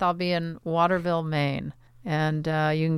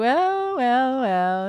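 pompous poet; 4 semitones higher, much slower than usual. Pretend. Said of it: "went into this character".

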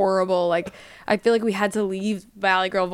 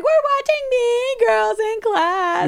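put-on high, slow gushing girl voice; excited teen girls; 4 dB louder, much slower than usual. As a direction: gush like thrilled schoolgirls. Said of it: "we were like".

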